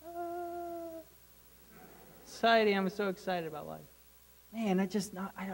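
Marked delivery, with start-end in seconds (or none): 0.1-1.0 flat mock ah. Mock flat thrill exclamation. 2.3-3.8 softer, deadpan mock excitement; a listless voice faking enthusiasm about life; mock bored. Mocking bored complacent attitude.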